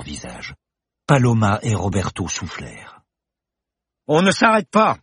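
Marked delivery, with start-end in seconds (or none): 4.1-5.0 much higher, louder yell; shout. Acted. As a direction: urge them on. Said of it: "hurla Bergton". (cri pressant)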